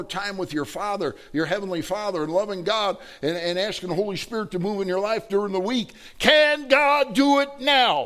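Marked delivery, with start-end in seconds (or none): 6.2-8.0 high, loud, drawn-out shout; full-force pulpit challenge; preaching climax. Real, not acted. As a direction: ring out each word slowly to the whole congregation.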